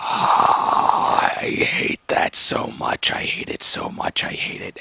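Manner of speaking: fading exasperated whisper. Real, not acted. Fed up muttering to self.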